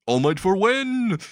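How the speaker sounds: high, slow triumphant cheer; victory cry. Retold or imitated. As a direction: stretch it into a high winner's cheer. Acted victory cry, hand raised.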